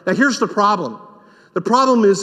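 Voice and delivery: ordinary talk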